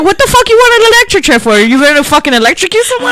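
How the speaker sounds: high, loud, fast demand; mock outraged friend. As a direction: play her fired up. Imitating Sophie's outrage.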